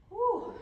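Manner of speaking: loud high puff of exhaled effort; effortful whew. Real, not acted. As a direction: blow out a big relieved whew. Exertion during hard yoga hold.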